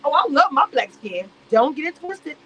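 a hint of a smug fake pride; mocking Amira. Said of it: "But you can".

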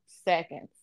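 usual speaking voice

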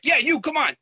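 single him out with a high cocky jab; taunting call. Retold or imitated. Nagia daring the narrator to come outside, high taunting call.

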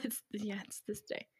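hiccuping whisper; hiccups. Real, not acted. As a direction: speak through the hiccups. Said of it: "I really can't stop hiccuping".

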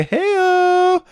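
high whooping call; excited cheer. Real, not acted. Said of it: "cheering shout out".

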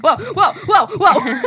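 laughing exclamation at a wild life; laughing whoa. Retold or imitated. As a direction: ride it like a roller coaster while laughing. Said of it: "and I'm like".

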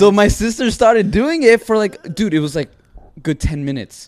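loud, high, excited telling; can't believe it, voice up. Real, not acted. Telling friends about sister and preacher, excited.

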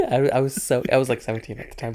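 ordinary talk

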